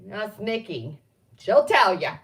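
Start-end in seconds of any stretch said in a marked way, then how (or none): 1.4-2.2 loud, punchy exclamation; calling out. Real, not acted. Naming Nikki as witness, called out loudly. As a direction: belt it out jokingly. Calls out friend as witness, then mutters.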